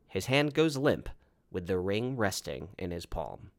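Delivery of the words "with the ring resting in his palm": ordinary delivery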